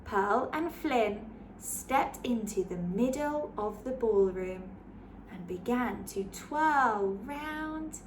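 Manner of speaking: put-on lively read-aloud for children; story narration. A narrator's reading. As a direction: read it like a bedtime picture book. Picture book read aloud.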